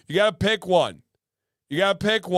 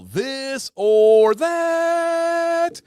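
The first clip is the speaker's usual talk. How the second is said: loud, high, drawn-out title call; game show announcer; 11 semitones higher, 6 dB louder, much slower than usual. Real, not acted. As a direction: belt out the game's name with long vowels.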